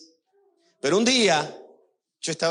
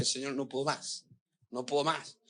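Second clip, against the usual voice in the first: hushed, near-tearful retold prayer, much softer; tearful plea; 9 dB quieter than usual. Pastor retelling his breakdown prayer to God, hushed and near tears. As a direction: breathe the words softly as if crying to God. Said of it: "y me senté y empecé a llorar y dije".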